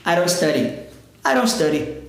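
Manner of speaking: "don't study" linked, sounding like "aron stary"; R-tapped "don't study". Shown as a demonstration. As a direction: say both Ds in "I don't study" as quick R taps. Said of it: "com som de R".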